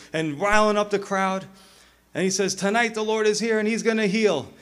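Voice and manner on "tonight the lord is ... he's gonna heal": high revival-tent proclamation; lightly hammed-up healer; faith healer. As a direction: proclaim it to rile up the crowd. Acting healer riling up crowd.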